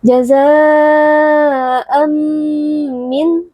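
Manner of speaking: "Jaza'an min" chanted high, long aa and nasal hum; prolonged dengung. Shown as a demonstration. Teacher showing idgham, prolonged hum joining "Jaza'an" to "min". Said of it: "dibaca dengan dengung yang dipanjangkan".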